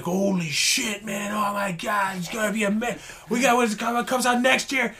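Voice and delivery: put-on excited high kid voice; little kid voice. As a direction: gush like an awestruck little kid. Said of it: "your little kid voice".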